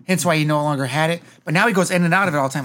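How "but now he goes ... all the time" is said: ordinary delivery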